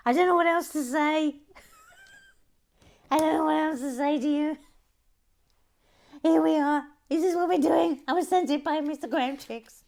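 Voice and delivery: high, loud flustered outburst; loud, high-pitched mock exasperation; exasperated protest. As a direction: plead helplessly at the top of your voice. Flustered defence to upset viewer.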